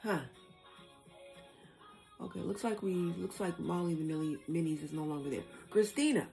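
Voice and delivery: ordinary talk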